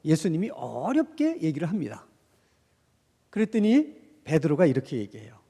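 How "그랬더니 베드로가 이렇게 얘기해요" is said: ordinary talk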